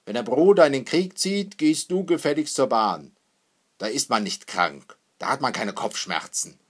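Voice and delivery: loud, commanding rebuke; stern scolding. A narrator's reading. Father orders his daughter to the station, loudly scolding. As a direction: lay down the law, no excuses allowed.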